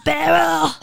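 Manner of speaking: put-on loud, high old-lady holler; hammed-up smoker granny shout. Podcast host tries a silly voice for reading terms, shouted old lady. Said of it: "Maybe, like, old lady".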